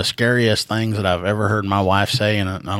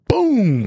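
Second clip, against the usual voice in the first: mock gunshot; 10 semitones higher, 3 dB louder than usual. Imitating the shotgun blast.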